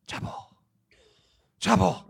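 playful Spanish-accented "Chapo"; light put-on Spanish accent. Shown as a demonstration. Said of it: "Say Chapo in a Spanish accent".

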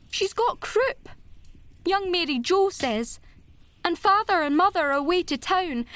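high, urgent, scared rush of words; a scared child pouring out bad news; frightened girl. A narrator's reading. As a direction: Diana telling Anne her sister is sick, high and frightened. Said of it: "implored Diana nervously".

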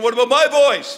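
sudden high alarmed question to himself; worried inner thought. Retold or imitated. Preacher recalls fearing he'd lose his voice, alarmed. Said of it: "I'm thinking".

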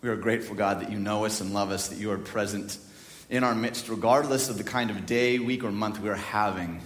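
low, soft praying voice; prayer. Real, not acted. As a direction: pray quietly and reverently to God. Leads an opening prayer.

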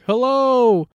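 loud, high, drawn-out holler; echoed yell. Pretend. Own voice coming back in the woods, loud high yell. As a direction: imitate your own call coming back at full volume. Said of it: "hear your own voice come back".

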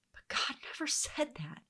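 hushed, pointed whisper. Real, not acted. Teacher correcting Eve's added words, in a whisper.

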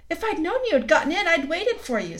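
a lightly voiced happy little sister; Lucy greeting her brother excitedly; excited girl. A narrator's reading.

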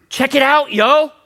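loud, high comic holler; playful shouted slang; slangy call-out. Acted. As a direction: holler it to your buddies across the street. Modern street version of behold.

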